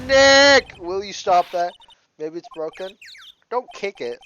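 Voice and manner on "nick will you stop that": sharp loud yell of a name; shout. Acted. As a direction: snap his name out loud. Friend yells at Nick.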